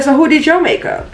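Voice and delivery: a lightly voiced, upset customer. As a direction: mimic her upset demand. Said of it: "she was, like, so, like, upset".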